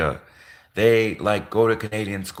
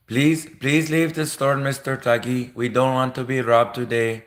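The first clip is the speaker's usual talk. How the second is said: pleading mock Indian shopkeeper accent; 3 semitones higher than usual. Shopkeeper asking Mister Tuggy to leave the store, mock Indian accent. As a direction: put on an Indian accent, begging the customer out. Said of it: "that was partly the Quickie Mart".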